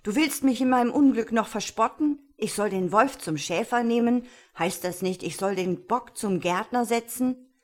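indignant. A narrator's reading. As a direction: throw the advice back angrily. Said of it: "rief der Mann erbost".

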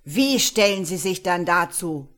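loud accusing shout at the prisoner. A narrator's reading. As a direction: bark the question at him. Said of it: "schrie Hopkins unseren Gefangenen an".

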